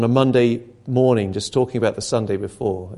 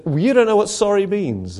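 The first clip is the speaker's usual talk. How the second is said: higher, sharp telling-off; teacher rebuking a pupil, raised and cross; retold anger; 6 semitones higher than usual. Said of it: "I remember getting quite angry".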